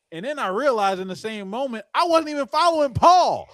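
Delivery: embarrassed excitement. Real, not acted. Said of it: "I felt so bad".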